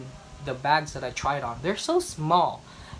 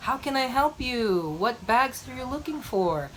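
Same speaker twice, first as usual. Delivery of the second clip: imitating a friendly sales assistant; 7 semitones higher than usual. Venice sales assistant greeting her.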